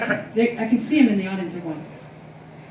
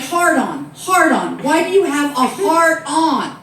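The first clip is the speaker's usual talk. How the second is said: insistent innocent question; 6 semitones higher, 7 dB louder, slower than usual. Retold or imitated. Friend insists loudly.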